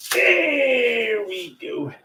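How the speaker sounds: high, slow, drawn-out mock cry; mock wail. Cheering the spaghetti into the pot with a drawn-out high mock cry. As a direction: wail it out theatrically.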